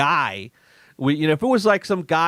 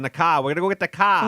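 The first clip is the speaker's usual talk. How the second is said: mock Boston accent, dropping R in "car"; 2 semitones higher, faster than usual. Shown as a demonstration. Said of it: "go to Boston".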